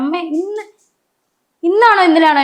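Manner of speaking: high childlike imitation of her son; little son asking. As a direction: voice the small boy's question up high. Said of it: "അവൻ എന്നോട് പറയാം". (മകന്റെ ഉയർന്ന കുട്ടിശബ്ദത്തിലുള്ള അനുകരണം)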